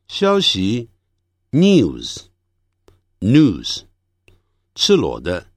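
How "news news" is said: first news "nyooz", second "nooz"; British, then American. Shown as a demonstration. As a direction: contrast "news" with and without glide. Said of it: "再把这几组字念一下". (先英式后美式念news)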